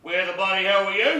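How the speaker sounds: loud greeting. Real, not acted. [name] demanding where Carl was, loud and cheeky. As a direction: hail your late mate with cheeky outrage.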